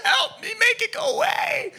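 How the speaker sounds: put-on very high, wailing childish plea; mock child's tearful wail; crying kid. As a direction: beg mom like a hurt little boy. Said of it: "I'm crying to my mom".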